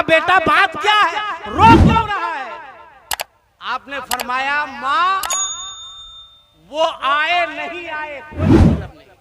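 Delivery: loud, high impassioned storytelling; preaching at full force. Acted. Preacher telling the mother and son scene, loud and impassioned. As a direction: keep the sermon at full pitch.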